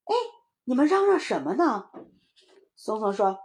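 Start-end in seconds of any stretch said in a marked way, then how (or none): 0.0-1.9 a louder, higher mum's query; questioning mother. Acted. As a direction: ask noisy children what's up. Mother asks about the noise. (妈妈提高嗓门询问)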